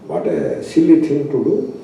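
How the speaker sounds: ordinary delivery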